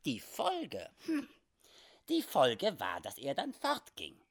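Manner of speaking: high, teasing little voice; quirky squeaky figure, dragging out the answer; little comic frontiersman. Acted. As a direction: answer with amused nonchalance, pitch swooping. Sam Hawkins answers teasingly.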